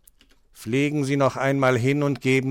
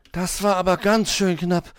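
put-on high child voice, just escaped; relieved; 6 semitones higher, 4 dB louder than usual. A narrator's reading. Hedgehog after the near crash, relieved. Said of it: "pustete erleichtert durch".

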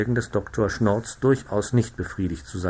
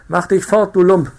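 loud, high barked insult; angry shout; 8 semitones higher, 8 dB louder than usual. Acted. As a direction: drive the tramp off the road. Servants chasing off the narrator.

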